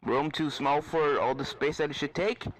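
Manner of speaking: dry questioning professor, lightly voiced; Professor asking. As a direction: professor double-checking the filly's hunch. Professor asks Apple Bloom.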